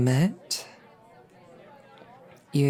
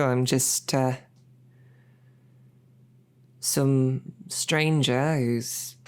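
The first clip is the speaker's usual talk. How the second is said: ordinary delivery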